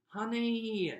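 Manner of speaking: high, drawn-out Southern drawl; deep Southern woman. Acted. Sister phoning brother in a put-on Southern woman's accent. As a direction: play up a slow Southern woman, pitched high. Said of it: "a really deep Southern woman".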